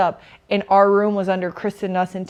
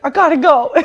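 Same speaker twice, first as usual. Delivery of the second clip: laughing; 10 semitones higher, 6 dB louder than usual. Real, not acted. Retelling friend's hasty exit.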